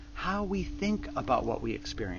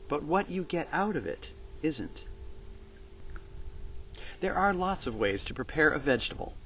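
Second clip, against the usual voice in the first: normal talking voice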